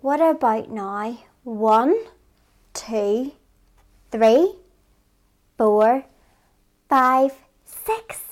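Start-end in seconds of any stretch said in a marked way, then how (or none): 1.4-8.2 put-on counting along with kids; teaching a child to count. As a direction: count slowly, each number lifted.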